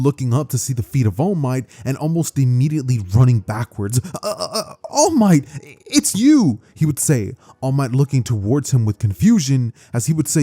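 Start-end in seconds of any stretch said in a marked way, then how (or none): 4.2-6.6 high, loud stammering surprise; startled stammer. Acted. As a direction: gasp it out, starstruck and startled. Startled boy meets his hero.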